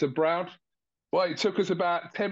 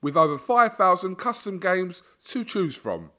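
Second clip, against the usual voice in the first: hyped betting ad; 4 dB louder than usual. Acted. Sponsor read for casino.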